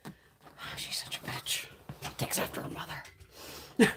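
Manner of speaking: soft unvoiced gossip whisper; whispered aside. Real, not acted. A joking whispered aside about her cat. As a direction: whisper it like a secret.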